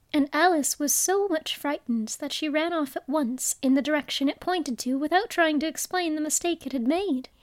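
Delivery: usual speaking voice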